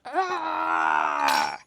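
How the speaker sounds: deep ghostly shout; low booming ghost cry; unearthly voice. Pretend. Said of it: "Can I talk like a ghost again?".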